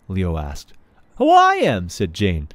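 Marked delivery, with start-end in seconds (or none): 1.2-1.9 put-on high, loud, bossy girl; proud little girl. Jane telling Leo she is king, proudly. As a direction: announce yourself as king.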